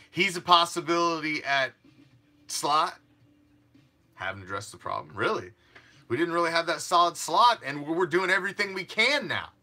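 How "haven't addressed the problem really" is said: muttered sarcasm. Retold or imitated.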